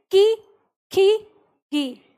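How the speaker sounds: की, खी, गी each ending in a long "ee"; consonants with ee. Shown as a demonstration. Teacher has pupils use the ee vowel, "kee, khee, gee" drilled separately. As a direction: sound out "kee, khee, gee" one at a time. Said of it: "ई की मदद से करो".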